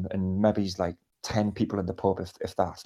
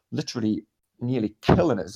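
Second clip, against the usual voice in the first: ordinary talk, nothing marked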